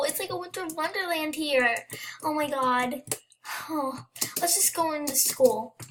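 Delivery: ordinary talk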